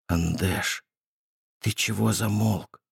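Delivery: hushed frightened whisper. A narrator's reading. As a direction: barely breathe the words. Whisper hiding from creature.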